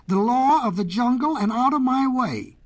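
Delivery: high, forceful proclamation; roaring lion. A narrator's reading. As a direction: lay down the law as a lion. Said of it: "roared the lion".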